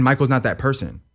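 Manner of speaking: normal talking voice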